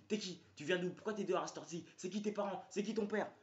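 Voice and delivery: questions crachées, plus haut et fort; mitraille-le de questions comme un flic; interrogatoire agressif. Retold or imitated. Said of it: "Et son pote qui me dit". (le pote du flic interroge, plus haut et plus fort)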